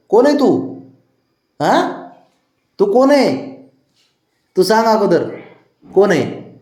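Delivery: high, playfully indignant questioning; teasing high-pitched interrogation; mock challenge. Pretends not to know pet.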